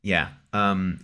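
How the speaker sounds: ordinary delivery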